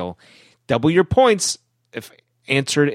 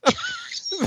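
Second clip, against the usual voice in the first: high-pitched laugh; 6 dB quieter than usual. Real, not acted. Laughing at tongue-twister.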